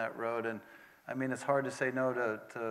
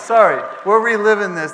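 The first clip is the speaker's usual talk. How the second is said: laughing apology; 8 semitones higher, 17 dB louder than usual. Real, not acted. Laughing at the memory.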